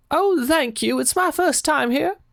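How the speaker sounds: high-pitched put-on old lady voice; grateful confused lady. Old lady thanking her helper at the door, high put-on voice. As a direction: pitch it way up as a sweet, befuddled lady.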